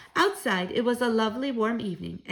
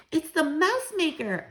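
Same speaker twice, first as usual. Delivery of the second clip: excited cry; 7 semitones higher than usual. Acted. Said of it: "I cried".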